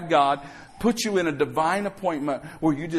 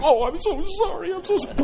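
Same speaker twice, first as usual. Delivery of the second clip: high-pitched, gushing fake apology; hammed-up sorry after a slap; mock apology; 14 semitones higher than usual. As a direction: play the sorry encourager, voice way up. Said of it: "I'm like".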